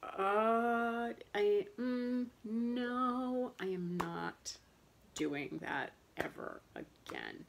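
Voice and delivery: emphatic refusal. Retold or imitated. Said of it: "And I was like".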